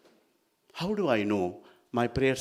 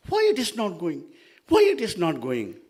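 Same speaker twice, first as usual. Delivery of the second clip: high-pitched exasperated complaint; frustrated; 7 semitones higher, 6 dB louder than usual. Acted. Mahavishnu pushing elephant into needle, high frustrated cry. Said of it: "he's feeling frustrated".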